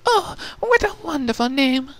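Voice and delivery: high, delighted squeal. A narrator's reading. Rarity gushing to Pupa about her name, squealing. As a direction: gush over the name. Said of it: "Rarity squealed".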